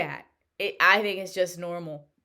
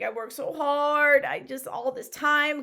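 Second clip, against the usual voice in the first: retelling her upset cry, pitched higher; a hint of tearful distress, higher; 8 semitones higher than usual. To husband after the exam, mildly upset and higher. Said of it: "I'm upset".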